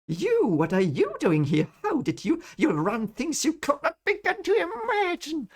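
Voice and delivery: high indignant female voice confronting Perrin; angry woman's voice. Acted. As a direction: voice her rising shock and anger. Reading the angry woman's line.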